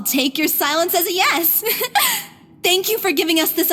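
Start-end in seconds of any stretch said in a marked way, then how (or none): 1.7-2.2 short laugh leaping much higher; sheepish high giggle; nervous little laugh. Acted. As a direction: laugh off the awkward silence. Embarrassed after a silent pause.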